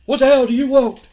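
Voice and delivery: loud, high irritated shout; angry demand. Acted. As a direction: shout at an unwelcome interviewer. Wrestler snapping at interviewer.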